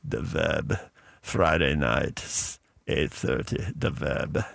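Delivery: put-on low, soft accented radio promo; Iranian DJ accent. Imitating Kayvon's accented radio promo, low and soft. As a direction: murmur the promo in a heavy accent. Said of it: "he had a heavy accent".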